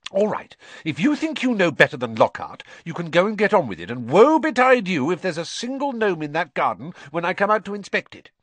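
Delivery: stern mother laying down a threat; a mild hint of a cross mum; Mrs Weasley threatening. A narrator's reading.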